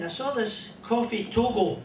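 ordinary delivery

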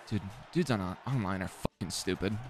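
soft, low-key grumble; muttered annoyance. Real, not acted. Gamer complaining about online players, muttered annoyance. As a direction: grumble it quietly to yourself.

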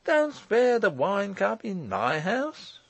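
high, drawn-out merriment. A narrator's reading. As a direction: play the lavish host, stretching the words. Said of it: "he cried gaily".

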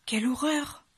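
high gasp, then dismay; aghast, voice jumping up; horrified exclamation. A narrator's reading. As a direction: exclaim in horror at the mirror.

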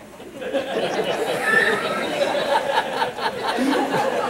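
laughing hard out loud; big real laugh, loud and high; laughing at the memory; loud burst of laughter. Real, not acted. Pastor laughs at story.

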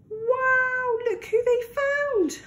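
high amazed wow; delighted wonder. Acted. Narrator revealing Mondy to listeners, high and amazed. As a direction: marvel at the surprise. Discovering the hen and chicks.

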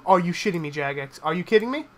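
usual speaking voice

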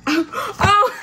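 high squealing laugh breaking into oh; can't hold back the laugh; laughing at the memory; burst of laughter. Real, not acted. Recalling a funny boot camp moment.

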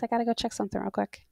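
fast whisper aside. Real, not acted. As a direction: whisper it quickly.

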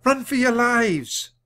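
high, loud, slow cry of alarm; frantic warning. A narrator's reading. Annie frightening the riders away, loud high warning. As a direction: scream the warning to scare them.

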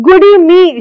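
high, loud gasp of surprise; surprised cry. Retold or imitated. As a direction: cry out, taken aback. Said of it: "she cried, as if, she was surprised".

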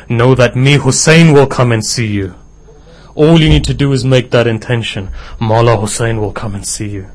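loud, rising, impassioned preaching; preaching at full force. Acted. Preacher tells the congregation Hussein's message, impassioned and loud. Climax of the sermon story.

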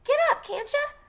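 very high, loud yell; frantic shout; screaming. Acted. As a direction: shriek at her to get up. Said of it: "Jem was screaming".

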